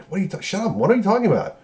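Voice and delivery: high-pitched mock protest; flustered denial. Retold Evans panicking, denying it.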